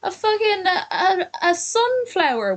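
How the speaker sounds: high, sing-song teasing voice; mock cutesy teasing. Teasing Kennedy for being small, in a high mock-cute voice. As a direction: tease her like she's a tiny pet.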